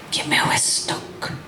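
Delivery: soft unvoiced whisper; a hushed whisper in the poem. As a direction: whisper it to the room. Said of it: "in a whisper".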